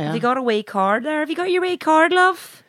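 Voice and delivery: high, rising put-on northern voice; shop worker impression. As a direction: chirp it like a northern cashier. Mimicking shop worker asking for card.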